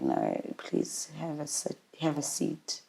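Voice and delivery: very soft, low retelling; quiet and low, with heavy pauses; breaking bad news. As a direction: break it gently, in a hushed low voice.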